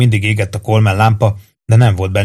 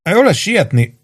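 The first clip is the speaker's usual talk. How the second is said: high, nervous urging; nervy and pitched up, but voiced; nervous whisper; 10 semitones higher than usual. A narrator's reading. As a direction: hurry your friend along anxiously. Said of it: "suttogta idegesen Péntek".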